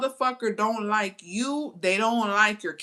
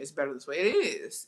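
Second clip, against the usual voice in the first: usual speaking voice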